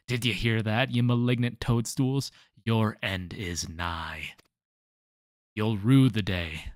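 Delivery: loud, slow menacing vow; hammy villain threat. Acted. Threatening the toadstools.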